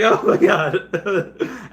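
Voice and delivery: very slow, high, laughing oh my God; overwhelmed laughing gasp. Retold or imitated. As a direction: melt into a giddy laugh. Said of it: "And I was like".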